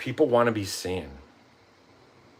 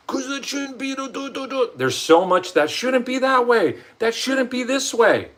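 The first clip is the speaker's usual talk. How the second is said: whiny protest; 14 semitones higher, 5 dB louder than usual. Retold or imitated. Vlogger mocking his own stubborn self, whiny. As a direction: whine like a sulky kid.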